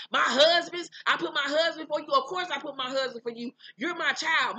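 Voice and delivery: imitating an affronted mother; indignant retort. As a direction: throw it back, offended and emphatic.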